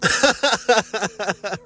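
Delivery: a short high chuckle; laugh. Real, not acted. Admitting she is no master, short chuckle. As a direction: chuckle at still being a student.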